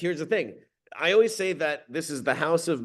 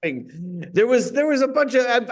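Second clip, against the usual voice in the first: ordinary talk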